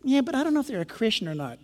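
soft, quick mock excuse; hesitant objector. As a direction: toss out a reluctant excuse under the voice. Voices a hesitant believer's objection.

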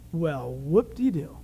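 slow, low deadpan mockery; sarcastic. Real, not acted. Preacher reacting to Peter's boast, sarcastic. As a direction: draw it out, deeply unimpressed.

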